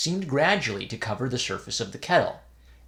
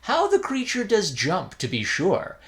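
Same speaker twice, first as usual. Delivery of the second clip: amused; 7 semitones higher than usual. A narrator's reading. Third novice amused.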